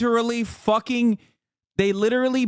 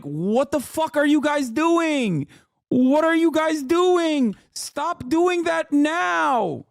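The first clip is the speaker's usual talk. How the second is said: higher, slow, exasperated yell; exasperated official; 9 semitones higher, slower than usual. Pretend. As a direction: scold them like a fed-up press officer. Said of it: "I'm like".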